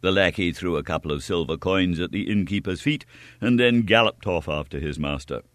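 usual speaking voice

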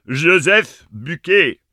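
loud, slow, high and grave; dire announcement. Acted. Ma breaks the terrible news, slow and loud. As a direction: announce the name with dread.

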